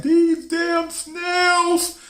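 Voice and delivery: high, very slow stretched-out yell; dad howling at the tank; mock dad yell. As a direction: drag it out high like a wail. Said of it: "My dad was like".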